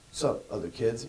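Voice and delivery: put-on "Sup, other kids?" said low and quiet, too cool to care; cool indifferent greeting. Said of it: "you don't go".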